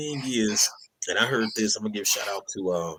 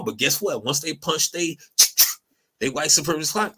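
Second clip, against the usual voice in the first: ordinary talk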